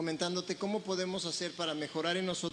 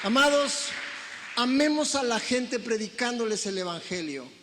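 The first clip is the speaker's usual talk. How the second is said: louder, impassioned preacher cry; fervent preaching; 4 semitones higher, 6 dB louder than usual. Real, not acted. Pastor urges the congregation, fervent preaching. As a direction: preach it with fire.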